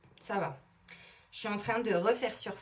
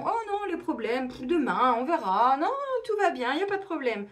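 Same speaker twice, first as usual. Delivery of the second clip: high, breezy brush-off of any worry; carefree dismissal; 4 semitones higher, 4 dB louder than usual. Retold or imitated. Imitating Africans waving problems away until tomorrow, carefree. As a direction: swoop up high on the reassurances. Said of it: "eux, ils sont, euh".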